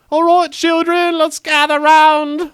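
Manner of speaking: put-on sing-song governess gathering the children; Mary Poppins nanny voice. Father mocks a British coach as a nanny calling children. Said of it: "Merry Poppins time".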